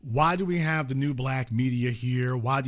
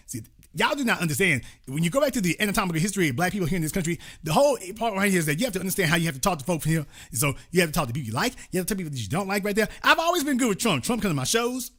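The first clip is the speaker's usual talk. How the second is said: mocking D L Hughley; 4 semitones higher, much faster than usual. Host imitating D L Hughley defending Trump ties, sassy mockery. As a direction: rattle it off like a slick comedian explaining himself. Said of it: "D L Hughley would get his little sassy ass".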